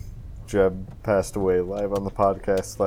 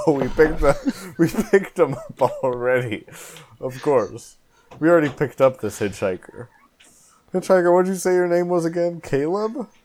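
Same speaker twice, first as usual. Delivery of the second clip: nervous laughing; 6 semitones higher than usual. Acted. Nervous cover-up before police.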